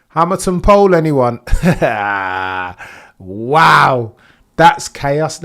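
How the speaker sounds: loud, high, laughing delight; excited glee. Real, not acted. Streamer thrilled about Hamilton taking pole, loud and high. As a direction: whoop it like great news.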